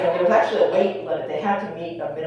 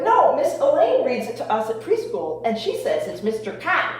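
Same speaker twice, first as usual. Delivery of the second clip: put-on son's Boston accent, Cop with no R; a kid insisting in a Boston accent; child's Boston accent; 6 semitones higher than usual. Son quoting his preschool teacher, in a Boston accent. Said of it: "okay, Boston accent".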